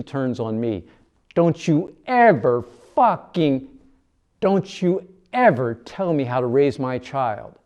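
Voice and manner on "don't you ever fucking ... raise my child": loud, slow, high furious warning. A narrator's reading. Mercy turning on the narrator, furious. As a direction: snarl each word at him.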